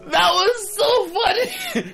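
high laughing delivery, drawn out. Real, not acted. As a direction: laugh through the words. Laughing at recalled joke.

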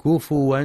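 كفوا said kufuwan, u after the f; waw for hamza. Shown as a demonstration. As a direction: glide through w in كفوا, kufuwan. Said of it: "لإبدال الهمزة واوا".

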